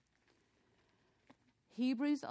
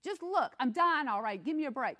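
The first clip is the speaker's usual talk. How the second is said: louder, higher, fed-up mock complaint; mock exasperated outburst; 5 semitones higher, 9 dB louder than usual. Dying father imagined snapping at his son, hammed-up exasperation. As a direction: throw it up as a fed-up, hammed-up gripe. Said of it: "He doesn't say".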